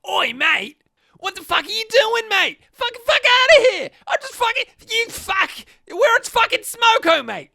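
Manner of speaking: guttural, shouted bogan Australian rant; full-force guttural bogan tirade; bogan accent. Shown as a demonstration. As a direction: yell it high and angry, aggressively Australian. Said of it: "more bogan, it's the more, like, guttural".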